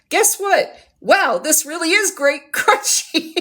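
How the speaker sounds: high, bright delighted gush; excited. Real, not acted. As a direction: squeal with delight, laughing. Delighted tasting the crunchy orange.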